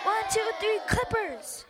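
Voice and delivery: high, loud team chant; team yell. A narrator's reading. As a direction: shout it like a huddle breaking. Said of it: "yelled the whole team".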